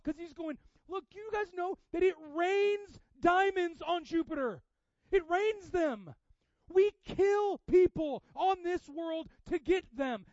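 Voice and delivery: loud, high-pitched exhortation; impassioned, sustained pulpit shout; full-force preaching. Real, not acted. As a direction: preach it urgently at full volume.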